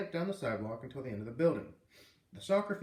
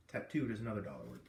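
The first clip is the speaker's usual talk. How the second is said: ordinary delivery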